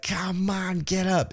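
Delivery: high, drawn-out "come on"; impatient high-pitched goading; imitated urging. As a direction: urge him up in a high imitation. Acts Shinsuke urging AJ up.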